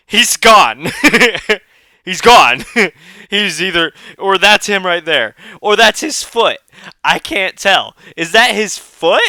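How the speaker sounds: loud, high, cracking up; laughing. Real, not acted. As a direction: barely get it out through laughter. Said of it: "this is making me laugh".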